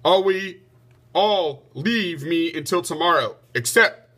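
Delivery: king's loud command to stop; shouting. A narrator's reading. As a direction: bark the order to halt the quarrel. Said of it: "King Arthur shouted".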